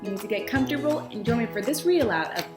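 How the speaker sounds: usual speaking voice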